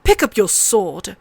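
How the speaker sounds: a touch of a commanding call. A narrator's reading. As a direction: order it as a drill master would. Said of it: "he called".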